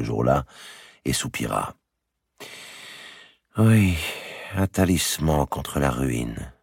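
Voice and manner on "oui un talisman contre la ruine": ordinary delivery, nothing marked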